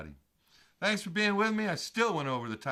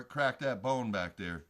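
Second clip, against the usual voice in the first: usual speaking voice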